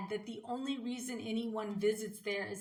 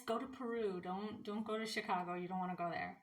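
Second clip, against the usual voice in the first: dropping to a softer, secretive warning; softer, like sharing a secret tip; conspiratorial hush; 8 dB quieter, faster than usual. Pretend. Joking warning to stay away.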